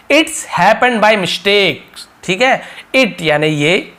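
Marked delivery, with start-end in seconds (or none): 0.1-1.7 words stretched apart; slow model sentence. Shown as a demonstration. Teacher slowly modelling an English sentence. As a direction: read it slowly for beginners.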